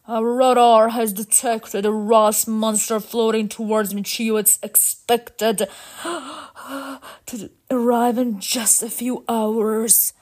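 grave robot announcement broken by a gasp; level robot voice, catching breath; robot leader, shocked. Acted. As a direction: deliver dire news as a shaken robot leader.

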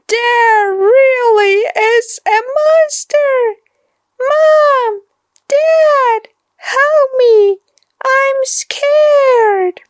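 high, loud crying for mom and dad; terrified child. A narrator's reading. Boy calls his parents, terrified and crying. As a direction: cry out in real fear.

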